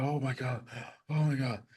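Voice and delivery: soft, winded gasp of words; retold as if catching breath; spent relief. Swimmer finally reaches the boat, softly winded.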